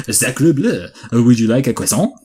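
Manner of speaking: a cliché French waiter accent, played for laughs; mock French accent. Shown as a demonstration. Showing his co-host how to start a French accent, hammed up. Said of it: "you wanna do a French, you start saying".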